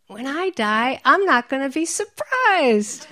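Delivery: high, loud, delighted punchline; gleeful punchline. Retold or imitated. Teacher delivering the joke about death, loud and joyful. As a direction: land the joke with loud glee. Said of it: "And he said".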